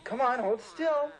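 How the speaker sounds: normal talking voice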